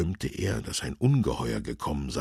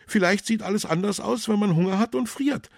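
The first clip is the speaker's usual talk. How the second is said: put-on high, louder, quick young man's voice; 6 dB louder, faster than usual. A narrator's reading. Young man speaks up for the thief, high and quick. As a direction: put it forward fast, pitched well up.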